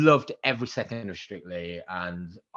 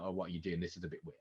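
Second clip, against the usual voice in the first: much softer, lower retold voice; puzzled aside; 4 semitones lower, 13 dB quieter, faster than usual. Partner puzzled as car passes the chemist, muted. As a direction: murmur it, mildly suspicious.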